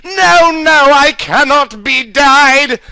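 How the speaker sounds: villain screaming as he bleeds; screamed. Acted.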